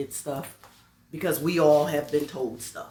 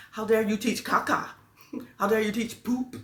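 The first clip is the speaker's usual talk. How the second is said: higher-pitched, half-laughing outrage; retold indignation; 5 semitones higher, 3 dB louder than usual. Teacher berating parents for teaching nonsense, comically indignant. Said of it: "I got angrier than I should".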